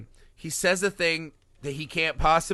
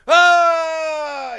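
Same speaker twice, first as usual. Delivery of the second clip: high, loud hammed-up yelp; mock pained shout; 13 semitones higher, 7 dB louder than usual. Retelling the movie, mock yelp of the stabbed doctor. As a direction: yelp like a man just slashed. Loomis gets the belly slice.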